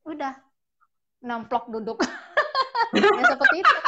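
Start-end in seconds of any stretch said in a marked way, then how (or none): 2.0-3.7 talking through a burst of laughter; laughing. Real, not acted. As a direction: break up laughing as you tell it. Amused retelling of child's antics. (sambil tertawa geli)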